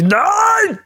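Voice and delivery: sudden high shout. Retold or imitated. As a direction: bark a sharp no at them. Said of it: "sag ich".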